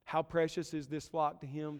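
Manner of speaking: ordinary talk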